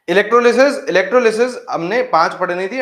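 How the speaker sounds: loud, high, excited boast to the class; excited announcing. Real, not acted. Teacher boasting to students about finishing topics, loud and excited. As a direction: proudly shout out the progress.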